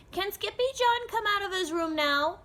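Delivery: put-on little girl voice. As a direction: ask sweetly like a little kitten sister. Little sister Jujubee asks.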